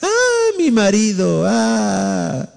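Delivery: a high hammed-up Ah wail, then a mock sob over the husband; mock weeping wail. Preacher mocking a wife crying over her husband, with a hammed-up high wail. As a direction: wail it like a cartoon crybaby. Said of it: "Estás llorando".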